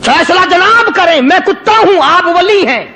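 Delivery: dog talking back. Retold or imitated. Dog rebuking the saint in a high, indignant voice. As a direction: answer back like a bold dog. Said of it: "کتے کو بھی زبان دی".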